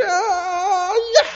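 high, loud charging yell; mock battle cry. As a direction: cry out like a mob rushing in with swords. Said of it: "We're like".

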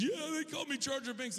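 high, whining mock-crying child; hysterical little boy. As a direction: imitate an upset five-year-old. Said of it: "he's hysterical".

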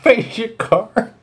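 put-on high old man voice; grandpa voice. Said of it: "I'll do my grandpa voice".

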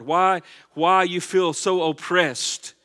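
usual speaking voice

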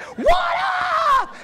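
huge high-pitched stage yell; mock a showy pastor's shouted greeting; hammed-up, very loud high holler; hip pastor. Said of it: "pastor with skinny jeans on being like".

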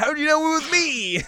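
very high, loud mock squawk; acted surprise. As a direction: squeal it, comically caught out. Acted surprised reply to being caught.

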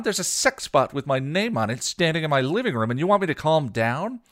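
high, loud indignant rant; outraged. A narrator's reading. Buffy rants at friends about the sex bot, outraged. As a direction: rail at the whole room.

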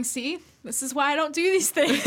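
lightly voiced I-told-you-so; Brian. Host imitates Brian watching the show, mock vindication. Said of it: "Brian's, like, watching it going".

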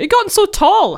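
put-on high cooing old aunt; great aunt voice. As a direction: coo it like a doting great aunt. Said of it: "it's like".

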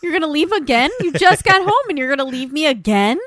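high, loud mock protest; hammed-up voice of an abandoned pet; indignant pet. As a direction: whine it, outraged at being left.

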